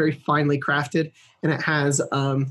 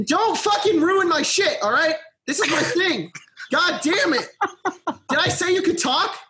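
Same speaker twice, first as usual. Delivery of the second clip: harsh barking commands; 11 semitones higher than usual. Pretend. Host snapping at players mid-intro.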